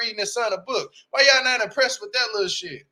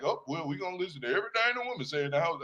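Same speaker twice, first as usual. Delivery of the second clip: softer, fast, mocking a meek husband; mocking meek man; 3 semitones lower, 9 dB quieter, faster than usual. Mocking the dad who obeys his wife, meek. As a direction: mumble it like a pushover.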